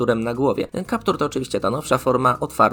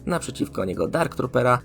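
ordinary delivery

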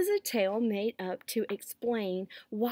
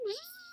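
thin mosquito buzz; sustained insect whine; whining buzz; 8 dB quieter than usual. A narrator's reading. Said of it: "whining in people's ears".

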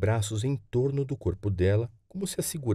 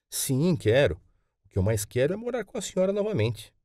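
lightly voiced boy, higher and keen; a hint of an eager child, pitched up; eager young grandson; 7 semitones higher than usual. Boy tells his grandmother he wants to live with her, lightly voiced eagerness.

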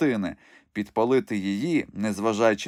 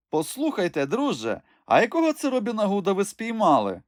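ringing voice; 10 semitones higher than usual. A narrator's reading. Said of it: "почувся дзвінкий голос з-під каптура". (дзвінкий насмішкуватий голос)

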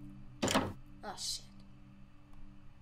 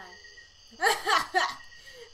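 loud, nervous burst of laughter; 5 semitones higher, 8 dB louder than usual. Real, not acted. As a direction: laugh off the fright. Scared by a butterfly.

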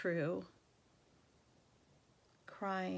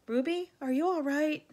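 higher, louder anxious call; worried call; 8 semitones higher, 7 dB louder than usual. Acted. Gorilla rushing to the window, calling worried. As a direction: call out to her in concern. Ivan rushes to worried Ruby.

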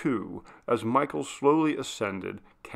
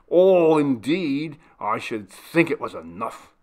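irate retort; 4 semitones higher, 8 dB louder than usual. Acted. The butler scoffing irately at Maria. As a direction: lean on it in anger. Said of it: "exclaimed Mister Skegg irately".

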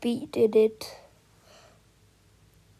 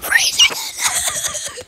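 drawn-out, very high strained cry; 3 dB louder, much slower than usual. Real, not acted. Groaning at a bad time, high drawn-out cry. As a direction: wail the number out slowly. Crying out at failed try.

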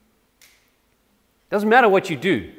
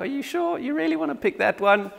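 doubtful wife; 3 semitones higher than usual. Retold or imitated. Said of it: "she was like".

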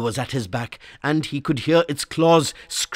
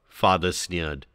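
ordinary delivery, nothing marked

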